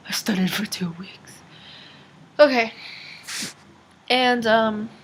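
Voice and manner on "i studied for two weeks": breathy secret whisper; hushed repeat of the same line. Shown as a demonstration. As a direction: lean in and whisper it close. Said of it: "Why am I so close?".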